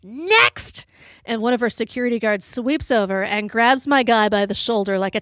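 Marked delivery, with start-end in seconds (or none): 0.0-0.8 high-pitched imitated yell; mock yell. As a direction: call it out high, as an annoyed celebrity dismissing a fan. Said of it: "she just yells out".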